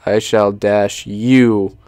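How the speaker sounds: usual speaking voice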